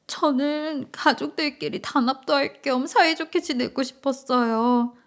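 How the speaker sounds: high, plaintive daughter-in-law; acted tearful. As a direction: apologise as if holding back sobs. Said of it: "며느리는 갑자기 울음을 터뜨렸어요". (훌쩍이며 하소연하는 며느리)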